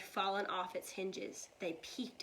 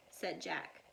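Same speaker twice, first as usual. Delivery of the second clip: usual speaking voice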